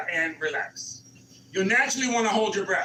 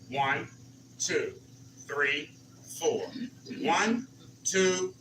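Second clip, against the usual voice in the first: coach counting reps; 7 semitones lower, 4 dB quieter, much slower than usual. Acted.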